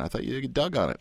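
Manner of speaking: usual speaking voice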